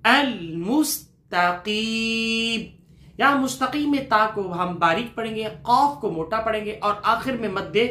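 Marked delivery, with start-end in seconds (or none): 0.0-2.8 very slow, drawn-out tajweed chanting; slow chanted recitation; slow Quran recitation. Shown as a demonstration. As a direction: stretch the word for students to echo. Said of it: "میرے ساتھ ساتھ پڑھیں گے".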